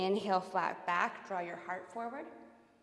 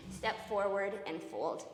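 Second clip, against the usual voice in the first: usual speaking voice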